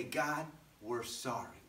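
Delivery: slow, low, softened repentance; humble apology. Acted. People of Nineveh praying to God, slow humble apology. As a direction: say it gently and contritely.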